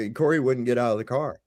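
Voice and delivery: usual speaking voice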